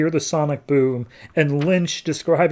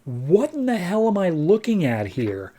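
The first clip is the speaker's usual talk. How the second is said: mock bewilderment; 4 semitones higher than usual. Team seeing a strange vision, hammed-up bewilderment. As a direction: act baffled, swooping up on the first words.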